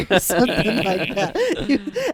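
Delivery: very high silly imitated cackle; stupid laugh. Imitating the gym trainers' dumb laugh, high cackle. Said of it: "Their laugh was just really, really stupid".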